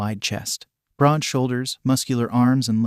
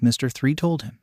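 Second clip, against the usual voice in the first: plain reading voice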